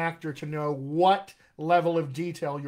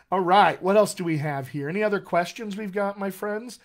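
normal talking voice

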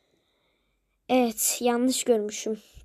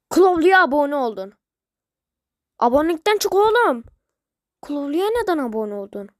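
angry shout; 2 semitones higher, 8 dB louder than usual. Real, not acted. Angry that he subscribed to a rival.

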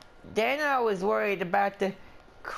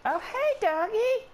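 high-pitched cooing hello; high doggy greeting; slower than usual. Pretend. Player spotting a dog in the game, high-pitched greeting.

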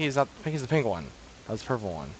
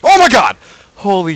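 much higher, much louder, then settling; startled scream; 9 semitones higher, 15 dB louder than usual. Real, not acted. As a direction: scream at a sudden scare. Jumpscare scream.

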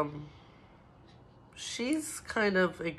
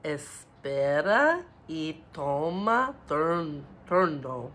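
slow model phrase; 4 dB louder, slower than usual. Shown as a demonstration. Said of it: "let's practice".

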